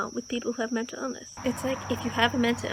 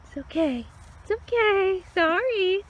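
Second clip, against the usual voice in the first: high, gentle reassuring coo; soothing pet talk; 8 semitones higher, 4 dB louder than usual. Real, not acted. Soothing deer at feeding.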